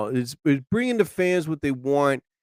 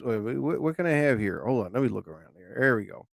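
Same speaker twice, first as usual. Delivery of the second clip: low muttering while searching; half-heard muttering to himself at the desk; 5 semitones lower, 8 dB quieter, faster than usual. Real, not acted. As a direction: mumble under your breath, hunting for something.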